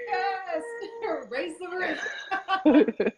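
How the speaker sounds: high, laughing "Yes, raise the roof!"; a delighted shout ending loud; excited cheer. Retold or imitated. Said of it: "I'm like".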